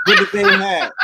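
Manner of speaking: high laughing squeal of a repeat; giggly high repeat; mock squeal.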